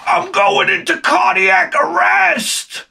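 stretched-out high mock scream of panic; mock panic shout. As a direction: overplay a kid sure he's dying. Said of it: "he grabbed his chest and he went".

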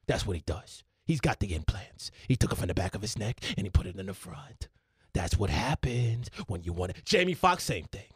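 low, hushed confidential pundit aside; Stephen A Smith. Acted. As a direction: drop far down, as if revealing a secret. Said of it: "Stephen A Smith".

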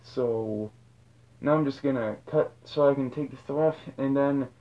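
muffled behind rubber mask; speaking inside full head mask; through a face mask. Real, not acted. Seen 2: Rubber mask covers whole head while talking; later on stand.

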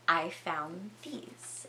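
slow, soft, ominous accusation; quiet menace. Retold or imitated. Storyteller voices Howard confronting them with tools, quietly menacing. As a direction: draw out each word quietly, threatening.

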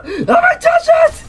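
extremely high-pitched eager shriek; excited scream. Real, not acted. Overexcited urge to touch game figure.

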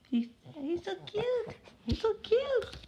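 very high squeal over the dog; squeaky praising a dog. Real, not acted. Gushing about his dog.

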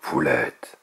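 very low, regal voice; deep kingly approval; dragon king. A narrator's reading. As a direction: confirm it in a deep royal voice.

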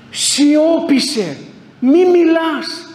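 slow, high, loud command to be silent; stern command. Retold or imitated. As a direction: order silence slowly and firmly. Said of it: "θα μας πούν κάποια στιγμή".

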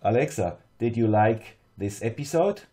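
ordinary delivery

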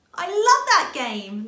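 high, loud, slow delighted squeal. A narrator's reading. Little rabbit squealing at a game of I Spy, excited. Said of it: "Little Rabbit squealed".

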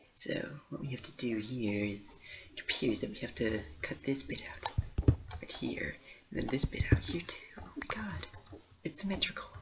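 hushed builder's whisper. Acted. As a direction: breathe the words, no voice.

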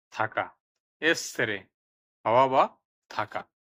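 loud, emphatic word-by-word dictation with falling endings; vocabulary drill. Shown as a demonstration. Teacher has learners repeat Italian words with Bengali meanings, emphatic drill.

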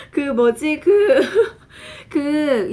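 high laughter breaking through words; laughing. Real, not acted. Teacher laughing through her words. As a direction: laugh while trying to go on. Laughing fit mid-lesson.

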